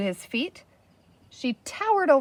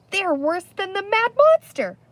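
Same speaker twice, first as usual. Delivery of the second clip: higher, louder fearful warning; terrified; 10 semitones higher, 5 dB louder than usual. A narrator's reading. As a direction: say it as if they're coming. Terrified chief.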